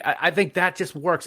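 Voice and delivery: ordinary talk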